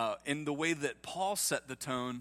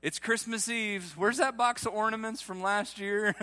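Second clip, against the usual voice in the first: much higher, louder squeal of glee; eager congregant; 7 semitones higher, 4 dB louder than usual. Retold or imitated. Congregant begging pastor to say it, squealing high. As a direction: mimic someone bursting to speak. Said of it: "Me and Sarah are like".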